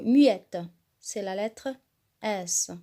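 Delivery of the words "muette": "muette" punched much louder and falling; emphatic muette. Shown as a demonstration. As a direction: hammer the word "muette" home. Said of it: "Il y a une lettre mu". ("muette" martelé, bien plus fort)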